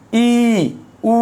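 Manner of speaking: "ই", "উ" held long as full vowels. Shown as a demonstration. Teacher shows class how full vowels sound, "ই", "উ" held long. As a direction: draw "ই" and "উ" out, high and steady. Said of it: "একটা পূর্ণ স্বরের উচ্চারণ কীরকম হয় দেখো".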